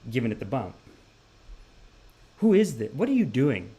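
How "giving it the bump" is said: ordinary talk, nothing marked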